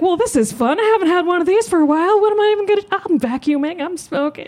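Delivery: high-pitched Southern mother, chattering; playful Southern impression of mom, pitched well up. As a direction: put on a high Southern lady voice. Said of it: "she's Southern".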